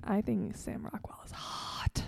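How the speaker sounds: breathy confessional whisper. Real, not acted. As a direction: hush it like a secret crush. Confessing a crush, unvoiced.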